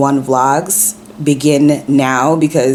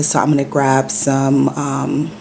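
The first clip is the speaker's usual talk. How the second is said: ordinary talk, nothing marked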